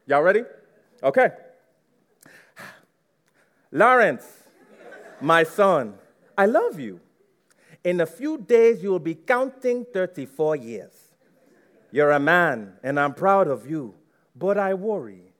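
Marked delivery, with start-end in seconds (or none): 3.7-15.3 lightly voiced old-school Nigerian mom; measured mock-motherly address; mother's voice. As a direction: read it out as a solemn, loving mother. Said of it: "I'm gonna say it to you as I heard it".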